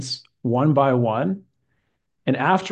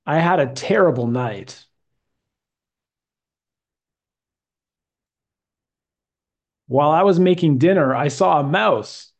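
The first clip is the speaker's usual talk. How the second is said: a teacher's reading aloud for the class to echo; model reading. Shown as a demonstration. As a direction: read it clearly with a careful rise and fall in the voice. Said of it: "listen to the way I say it".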